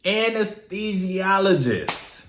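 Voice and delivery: anesthesiologist said slowly, syllable by syllable; slow careful pronunciation. Shown as a demonstration. Vlogger nails a hard word, slow and careful. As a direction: sound out anesthesiologist deliberately.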